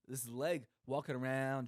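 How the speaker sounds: ordinary talk, nothing marked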